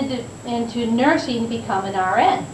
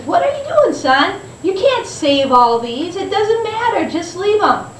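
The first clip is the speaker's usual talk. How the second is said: a father's voice pitched up high, chiding; 8 semitones higher than usual. Retold or imitated. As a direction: gently scold the boy as his father. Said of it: "His father says".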